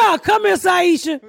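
high call across the room. Real, not acted. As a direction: call her over. Calling out to someone.

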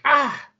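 high sharp "Ah!" of a stab; pained cry. Acted. Phase stabbed by a stalactite, a high cry of pain. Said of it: "She's like".

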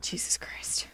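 whispered disbelief, breath only. Real, not acted. Reacting to the co-host's joke with a whispered groan. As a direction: mutter it under your breath in mock despair.

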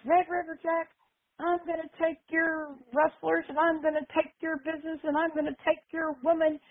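high, sustained mock promo voice; wrestler's challenge. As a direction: imitate a heel calling out his rival. Retold wrestler's threatening challenge.

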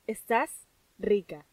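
slow, drawn-out "Estás rica" as a personal compliment; slow affirmation. Shown as a demonstration. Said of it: "pero aquí va, ¿ok?".

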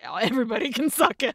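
fast, dismissive brush-off; dismissive mom. Retold or imitated. Mom storming upstairs, tossing a fast brush-off. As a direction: toss it off like a fed-up mom.

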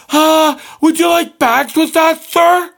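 very high, fake customer-service chirp; mock robot cashier. Cashier asking a customer about bags, mocking high robot voice. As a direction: put on a high mindless cashier voice. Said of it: "do your robot bullshit and go".

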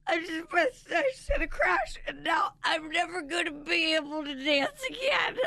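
high-pitched mock wail; imitated crying. Mom mimicking daughter after the crash, wailing. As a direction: wail it like a melodramatic little girl. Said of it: "she just starts crying".

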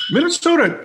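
mock Minnesota lilt on "Minnesota"; Minnesota accent. Host teasing guest's roots, mock Minnesota accent. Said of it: "growing up in Minnesota".

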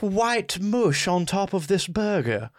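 high, slow, appalled fancy English; hammed-up upper-class English distaste. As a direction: query it in disgusted mock-posh English. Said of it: "Is this fancy English".

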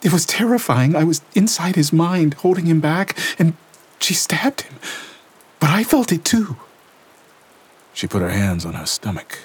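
put-on high, tense young woman's voice reliving horror; Lena shaken. A narrator's reading. Lena telling Gabriel what she felt, shaken and tense. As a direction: voice Lena trembling through the vision. Lena recounts the stabbing vision.

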